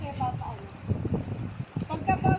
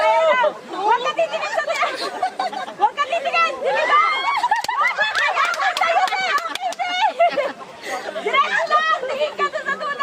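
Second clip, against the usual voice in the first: excited cheering; 7 semitones higher than usual. Real, not acted. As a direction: cheer them on to jump. Cheering a friend before jumping.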